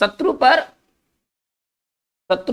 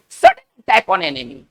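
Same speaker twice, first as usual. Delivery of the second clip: slow dictation; 4 semitones lower, 4 dB louder, much slower than usual. Shown as a demonstration. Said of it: "teacher dictating meaning".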